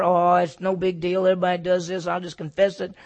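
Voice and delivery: mock shrug. Mocking careless sinner.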